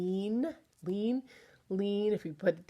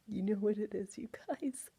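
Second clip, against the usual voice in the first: conspiratorial whisper; 3 semitones higher, 7 dB quieter than usual. Pretend. Teasing secret to kids.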